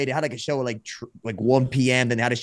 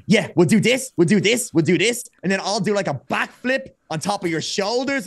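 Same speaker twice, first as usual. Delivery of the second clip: eager, higher-pitched rapid-fire pitching of wild moves; bonkers Speedball; 5 semitones higher, 3 dB louder than usual. Retold or imitated. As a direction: imitate an overexcited friend pitching stunts. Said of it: "he's pure bonkers".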